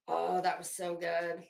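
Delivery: ordinary talk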